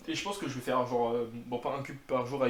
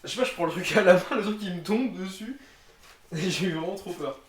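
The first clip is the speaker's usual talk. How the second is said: excited retelling; 4 semitones higher, 8 dB louder than usual. Retold or imitated. Retelling the scare.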